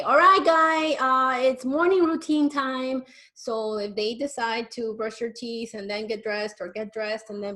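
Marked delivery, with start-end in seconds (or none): 0.0-3.0 loud, high, cheery announcement of morning routine; parent announcing routine. Shown as a demonstration. Parent announcing morning routine to the kids, loud and cheery. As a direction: call it out like a parent rallying the kids. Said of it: "you can say".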